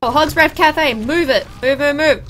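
punchy game shout; urgent callout. Real, not acted. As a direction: hurry the team along, loudly. Urging team on in game.

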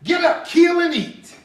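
high, loud command to Peter; voice from heaven. Retold or imitated. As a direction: call it out as a ringing divine order. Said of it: "the instructions to Peter is".

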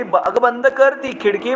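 a mother sharply calling her daughter to shut the window. Acted. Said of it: "आई ओरडली".

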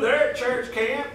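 ordinary talk, nothing marked

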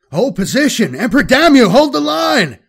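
building from a firm order to a shrill yell; commanding shout. Acted. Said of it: "Colonel Marhold's voice became like a persistent whine".

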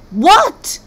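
high, loud scornful snap; sneering what. Acted. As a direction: scoff at the men's foolish idea. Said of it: "sneered all the women".

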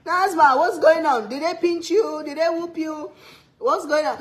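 high, loud re-enacted questioning; alarmed mother. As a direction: fire worried questions down the phone. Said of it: "When I call her".

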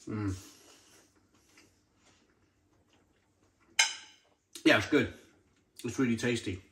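chewing between words; talking through food; mouth full. Real, not acted. Seen 0: Eats sausage just before; mouth works food while speaking.

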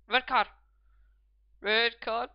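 insistent, loud demand to the referee; loud appeal. Real, not acted. Gamer appealing to the referee for a card, loud and urgent. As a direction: call out urgently for a sending-off.

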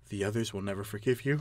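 low, very soft narration; whispering. A narrator's reading. Cabbage speaking in the player's ear, hushed whispering. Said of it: "Cabbage whispers in your ear".

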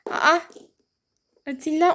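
ordinary delivery

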